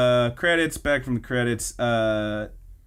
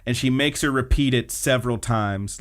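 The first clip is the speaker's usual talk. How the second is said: ordinary talk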